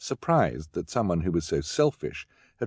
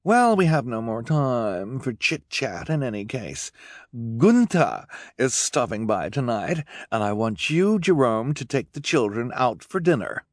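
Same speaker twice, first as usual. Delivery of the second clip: self-important Esme, pitched up; 5 semitones higher than usual. Acted. Esme dismissing chit-chat to send Jerome out, haughty.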